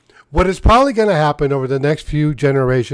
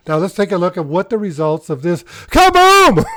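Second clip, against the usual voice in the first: ordinary talk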